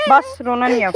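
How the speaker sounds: mother calming her crying son, loudly; soothing. Real, not acted. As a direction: hush him, firmly.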